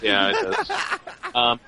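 ordinary talk, nothing marked

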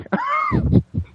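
howling laugh; big laugh. Real, not acted. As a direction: crack up at the story. Laughing at own impression.

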